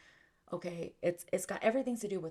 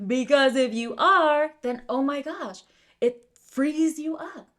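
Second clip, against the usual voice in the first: exuberant; 8 semitones higher, 15 dB louder than usual. Real, not acted. Coach telling listeners what frees them, exuberant. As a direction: belt it out with rising excitement. Exclaiming the payoff of self-knowledge.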